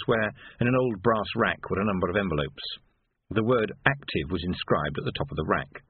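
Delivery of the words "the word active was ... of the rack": plain reading voice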